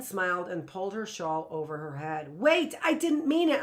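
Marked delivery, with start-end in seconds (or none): 2.4-3.6 higher, louder desperate cry. A narrator's reading. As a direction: beg her to undo it. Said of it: "I cried".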